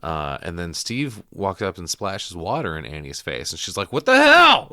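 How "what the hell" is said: very high, very loud shout; mock shocked shriek. Mother splashed in the face with water, mock shocked shriek. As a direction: yell it as if suddenly doused, hammed up.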